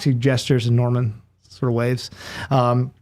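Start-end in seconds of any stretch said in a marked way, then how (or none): none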